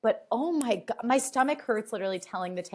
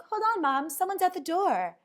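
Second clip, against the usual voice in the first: higher daughter voice, casual; high voiced daughter; 11 semitones higher than usual. Daughter telling her mom to hold on, high voice.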